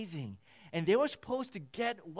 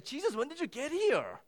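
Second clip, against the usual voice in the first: much higher, louder, eager questioning of Jesus; eager crowd asking; 12 semitones higher, 5 dB louder, faster than usual. Acted. The crowd pressing Jesus about when he arrived, eager and high. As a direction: call out like a crowd that has been searching. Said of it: "Their question is".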